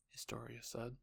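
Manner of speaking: ordinary delivery, nothing marked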